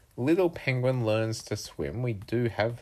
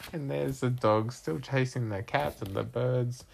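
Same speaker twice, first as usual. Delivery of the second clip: ordinary delivery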